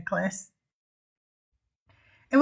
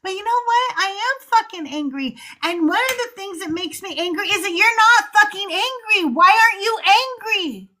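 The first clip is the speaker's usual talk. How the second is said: angry outburst; 8 semitones higher, faster than usual. Retold or imitated. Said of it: "And then I thought".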